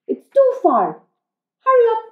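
high, loud mum voice; hurrying mum. Acted. Mum hurrying Oliver for the bus, high and loud. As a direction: hurry the child along in a high voice. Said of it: "said his mother".

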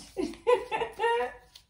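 high-pitched, loud burst of laughter; laughing. Real, not acted. Tarot reader amused by her own Queen act, real laughter. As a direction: crack up at your own joke.

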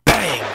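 loud voiced gunshot bang. A narrator's reading. Narrator voicing the final gunshot, loud bang. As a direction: punch out a gunshot.

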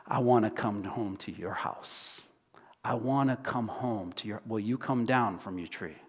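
soft, low, intimate plea; quiet loving appeal; tender invitation. Acted. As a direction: invite them gently, almost hushed.

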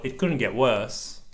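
ordinary delivery

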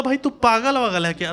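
normal talking voice

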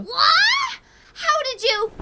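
alarmed shocked cry; shocked shout. Acted. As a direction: blurt it out in disbelief. Stranger knows her name.

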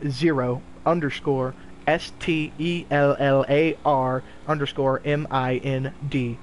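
slow, letter by letter; spelled out slowly. Shown as a demonstration. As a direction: spell each letter out carefully. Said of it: "Repeat the username".

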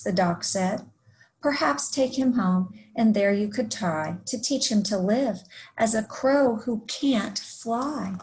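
lively rhyming story read-aloud; picture-book narration. A narrator's reading. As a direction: read a rhyming tale to young children.

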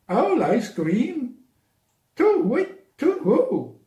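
high, hooting alarm; frightened owl hooting to whit, to who; startled owl. Acted. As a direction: squeak it out like a scared owl.

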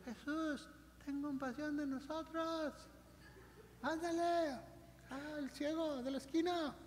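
meek whispered-sounding plea to Jesus; timid meek prayer. Shown as a demonstration. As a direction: pray as if afraid to bother anyone. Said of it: "No, dice la Biblia que gritaban".

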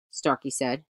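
ordinary delivery

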